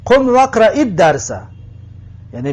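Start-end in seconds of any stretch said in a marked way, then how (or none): 0.0-1.3 higher, louder command "قم و اقرئی الدرس"; teacher voicing the order to stand and read; mock command. Shown as a demonstration. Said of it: "teacher acts out the command".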